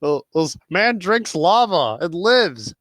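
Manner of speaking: slow, loud mock headline; reading a headline. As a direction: announce it like a newspaper headline. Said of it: "looks at one of the articles".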